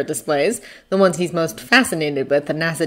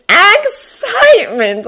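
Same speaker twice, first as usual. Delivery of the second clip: "excitement" high, loud and stretched; drawn-out, thrilled peak; unrestrained excitement; 8 dB louder, much slower than usual. A narrator's reading. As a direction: burst out on the word excitedly. Narrator acts out unrestrained excitement.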